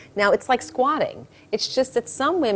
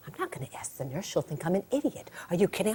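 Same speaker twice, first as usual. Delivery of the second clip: much softer reluctant husband; hushed refusal; 9 dB quieter, faster than usual. Acted. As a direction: object under your breath. Husband refuses under his breath.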